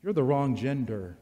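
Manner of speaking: ordinary talk, nothing marked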